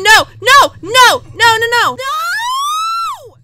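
loud high noes, breaking into a long scream; real screamed despair. Real, not acted. Gamer loses the last round, screamed despair. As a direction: scream no as victory slips away.

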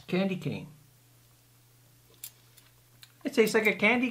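chewing while talking; speaking between bites; mouth full. Real, not acted. Seen 0: Man in cap bit cookie at -3, holds it, chews between words.

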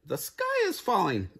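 high, squeaky alarm; puffing birds. A narrator's reading. Fleeing birds warn the goose, high squeaky alarm. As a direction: squeak out the warning. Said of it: "they puffed".

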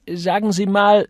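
high, loud bleat; worried bleating. A narrator's reading. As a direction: bleat it out like a fussing busybody. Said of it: "blökte er besorgt".

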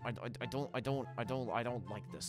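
nervous stammer. Acted.

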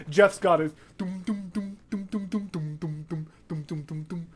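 quiet mouthed bass line doom doom; soft bass imitation. Shown as a demonstration. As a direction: hum the bass softly under your breath. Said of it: "like that thing going on".

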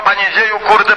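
usual speaking voice

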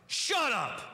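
high, curt mock snap; snappy retort. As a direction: toss it off like a spoiled brat. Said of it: "How about another cry?".

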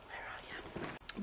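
slow, very soft whispered call; hushed slow question. Real, not acted. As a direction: whisper it, searching for the pet. Calling softly for pet.

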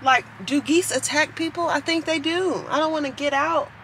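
high, nervous worry about the geese; scared. Real, not acted. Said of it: "I'm really scared of birds".

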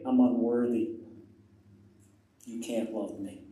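low, quiet self-rejection; feeling unworthy. Retold or imitated. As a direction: voice a hidden thought softly, dejected. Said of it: "they feel so bad about themselves".

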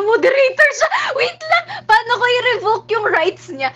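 high loud panicked outburst; alarmed shout. Real, not acted. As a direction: yell in sudden alarm. Alarm, moderator realized.

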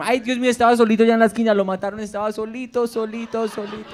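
a mother mourning on the couch, trailing away; wailing Colombian mom. Retold or imitated. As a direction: Colombian mom grieving a TV character, high and fading. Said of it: "Watch it with my mom".

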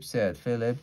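ordinary delivery

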